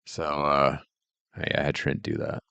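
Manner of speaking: ordinary talk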